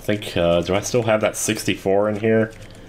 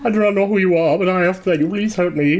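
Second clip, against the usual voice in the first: high panicked voice; 12 semitones higher than usual. Acted. Voicing panicked NPC character.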